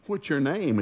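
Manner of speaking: put-on high-pitched talk-to-a-kid voice; adult addressing small child. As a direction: imitate a grown-up bending down to a little boy. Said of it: "people would bend over at church".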